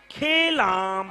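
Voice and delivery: very slow, dragged-out "খেলাম" with the vowel pulled long; grudging drawl. Retold or imitated. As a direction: stretch "খেলাম" out reluctantly, like a complainer who is not pleased. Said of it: "মানে খেলাম মানে টান মারলো".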